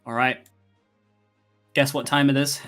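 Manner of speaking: ordinary talk, nothing marked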